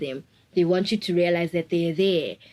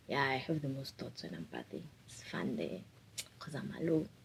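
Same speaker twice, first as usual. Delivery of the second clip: quiet aside; 5 semitones lower, 15 dB quieter than usual. Real, not acted. Confiding bathtime aside.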